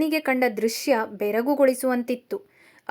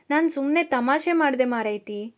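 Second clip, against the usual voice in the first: ordinary delivery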